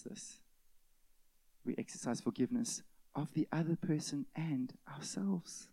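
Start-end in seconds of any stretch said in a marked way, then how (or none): 1.6-5.7 whisper. Acted. Whispered emphasis.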